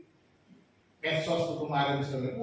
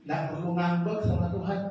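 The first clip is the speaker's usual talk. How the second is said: ordinary talk, nothing marked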